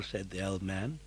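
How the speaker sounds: plain reading voice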